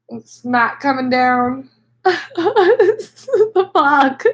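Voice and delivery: runny nose after crying; snotty crying. Real, not acted. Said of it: "My nose is running".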